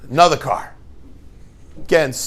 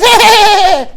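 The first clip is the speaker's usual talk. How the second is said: high, loud calls of hey; a kid shouting to get a driver's attention; kid calling out; 11 dB louder than usual. Retold or imitated. Kid in the street calling to the honking driver, high and loud.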